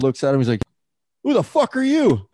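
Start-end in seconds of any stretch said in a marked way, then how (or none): 1.2-2.3 high-pitched imitation of a cheeky four-year-old; mouthy little kid. Four-year-old snapping back at a friend's dad, mock little-kid voice. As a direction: squeak it indignantly like a tiny wild kid. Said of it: "he's probably, like, four years old".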